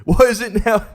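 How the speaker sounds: high, loud put-on Scottish rant; mock Scottish accent slipping into chavvy British; The Critical Drinker. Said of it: "I'm not the critical drinker right here".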